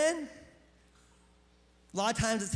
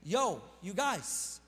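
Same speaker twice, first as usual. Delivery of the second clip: slow mock call-out; 4 semitones lower, much slower than usual.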